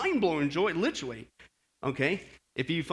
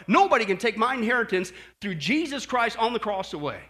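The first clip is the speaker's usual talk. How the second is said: ordinary talk, nothing marked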